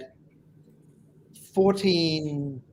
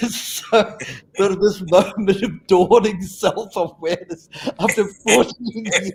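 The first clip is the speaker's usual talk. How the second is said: laughing through it; 4 semitones higher, 6 dB louder, slower than usual. Real, not acted. Laughing at the punchline.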